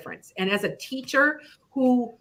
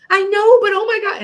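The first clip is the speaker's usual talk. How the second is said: high, loud, panicked pleading; panicking daughter; 12 semitones higher, 9 dB louder than usual. Retold or imitated. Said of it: "she called me crying".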